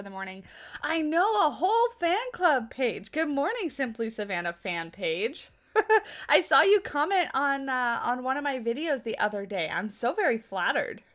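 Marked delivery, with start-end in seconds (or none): none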